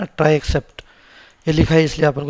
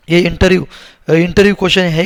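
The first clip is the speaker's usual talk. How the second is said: louder, punching interview question; emphatic call-out; 3 semitones higher, 8 dB louder than usual. Real, not acted. Teacher to students, loudly stressing it's an interview question. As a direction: hammer home that interview question matters.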